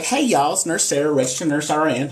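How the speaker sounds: high, bright-pitched Southern twang mimic; country accent imitation. Mocking a review teacher's country accent greeting. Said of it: "she's like".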